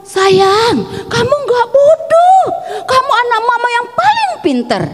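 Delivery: high-pitched, cooing mother voice; acted motherly reassurance, pitched well up; comforting mama. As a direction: soothe a crying child as a doting mama. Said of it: "Tapi mama kita bilang apa?".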